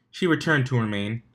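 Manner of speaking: ordinary delivery, nothing marked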